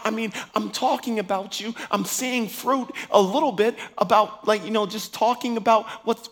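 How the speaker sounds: ordinary delivery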